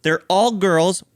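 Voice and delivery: ordinary delivery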